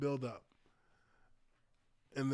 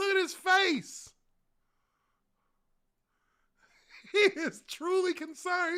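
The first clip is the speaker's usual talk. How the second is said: high, giggly through the laughter; laughing; 14 semitones higher, 6 dB louder than usual. Real, not acted. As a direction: squeak it out while cracking up. Cracking up at a face.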